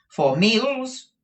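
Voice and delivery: high, loud, very slow; drawn-out doubtful madam; haughty Madame Mantalini. Acted. Madam responds to Ralph's assurance, high haughty drawl.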